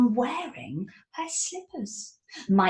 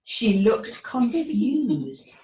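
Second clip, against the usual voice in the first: ordinary delivery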